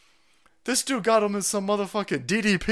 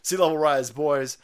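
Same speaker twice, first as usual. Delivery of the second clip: ordinary talk, nothing marked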